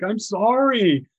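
high, loud mock-whiny pleading; bawling apology. Driver pleads as she's up close in the chase, bawling apology. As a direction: imitate a bawling baby begging forgiveness. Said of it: "like, a bawling baby".

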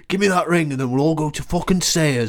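comic broad northern English; Boromir's northern accent. Acted. Imitating Boromir wanting the ring, broad northern accent. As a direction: lay the northern accent on thick. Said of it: "Where's your northern accent?".

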